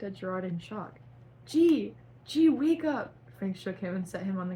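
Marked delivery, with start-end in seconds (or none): 1.4-3.1 high, loud plea to wake him; hammed-up desperate shout; desperate call. Shaking dead friend awake.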